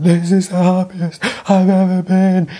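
loud, high, slow tearful joy; overjoyed cry. Retold or imitated. Telling Phil at the pool, tearful ecstatic shout. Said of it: "I was shooting tears out of my eyes".

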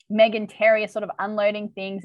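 ordinary talk, nothing marked

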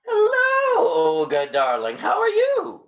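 high, gushing falsetto greeting; put-on falsetto phone voice; posing as Alastair. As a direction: swoop up high to greet a darling.

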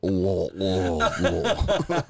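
mock gagging through laughter; laughing fake gag sounds. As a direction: gag noises while cracking up.

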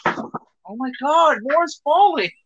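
high, slow alarmed exclamation; startled cry at the falling stuff; surprised. Real, not acted. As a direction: react to things toppling over. Things in the room fall again.

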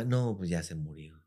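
low, soft and slow; mom's quiet verdict. Retold or imitated. Mom telling the kids the bird died, low and soft. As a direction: say it gently, slowly, matter of fact.

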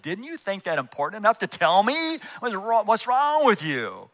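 retold mock exasperation; exasperated rebuke. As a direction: scold him in disbelief. Scolding the leader in retelling.

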